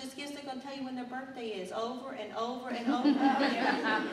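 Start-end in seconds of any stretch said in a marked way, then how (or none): 1.7-4.1 laughing. Real, not acted. Teasing about birthday reminders.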